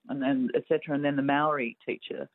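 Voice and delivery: usual speaking voice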